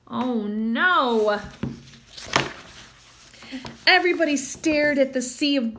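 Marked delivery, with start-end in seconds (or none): none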